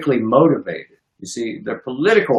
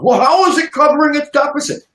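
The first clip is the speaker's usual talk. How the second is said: mock critic; 12 semitones higher than usual. Mocking a critic's objection.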